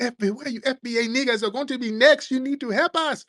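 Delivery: high, pleading put-on voice; mock immigrant plea. Immigrant asking FBA for help in a high pleading mock voice.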